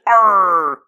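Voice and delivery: a pirate's loud Arr; pirate growl. Shown as a demonstration. Explaining where pirate talk came from, a hammed-up Arr. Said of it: "The whole pirate accent".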